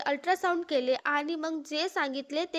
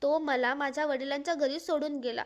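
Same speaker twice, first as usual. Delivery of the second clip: usual speaking voice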